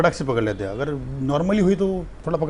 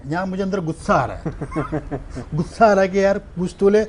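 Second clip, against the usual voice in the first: ordinary talk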